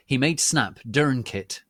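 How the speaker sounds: usual speaking voice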